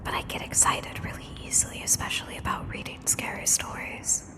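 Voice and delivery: unvoiced close-mic whisper. Shown as a demonstration. Streamer admitting they get into the reading, whispering. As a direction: whisper it right up to the microphone. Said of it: "just be whispering like this".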